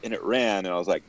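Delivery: ordinary delivery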